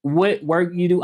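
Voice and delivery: ordinary talk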